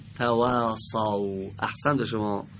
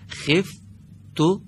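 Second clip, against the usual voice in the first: خفتکم drawn out slowly and higher; slow model; 7 semitones higher, much slower than usual. Shown as a demonstration. Teacher modeling word for student, slow. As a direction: stretch خفتکم like a recitation model. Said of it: "دوباره بفرمایید".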